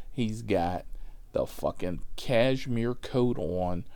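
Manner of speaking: ordinary talk, nothing marked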